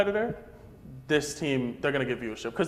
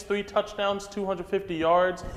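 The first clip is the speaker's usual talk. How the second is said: normal talking voice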